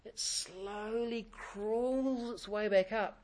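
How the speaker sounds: drawn-out words, like the boat crawling up; slowed down. Shown as a demonstration. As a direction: stretch every word slowly. Describing ferry rolling back up.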